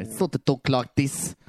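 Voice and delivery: Antipodean accent drifting into South African; botched New Zealand accent, sounding South African; attempted Antipodean accent. Shown as a demonstration. Speaker shows co-host how Kiwis and Australians talk, accent slipping into South African. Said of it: "Oh, that's South African".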